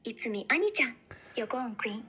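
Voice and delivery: put-on cutesy Japanese-accented English; English as a kawaii Japanese anime girl. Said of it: "Speak English, though, but with the Japanese anime girl accent".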